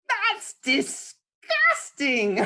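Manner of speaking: high, slow, grossed-out "that's disgusting"; disgusted child. Acted. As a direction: stretch it out, truly grossed out. Child character reacting with disgust.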